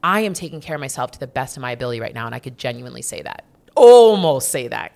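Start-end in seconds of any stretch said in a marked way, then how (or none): 3.8-4.9 wry correction. Real, not acted. Correcting herself wryly.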